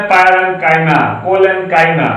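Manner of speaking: parenchyma said as "paren-kaima", with a clear k sound and not "china"; correct pronunciation. Shown as a demonstration. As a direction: demonstrate parenchyma carefully, with a hard k in the ending. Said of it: "सही pronunciation है".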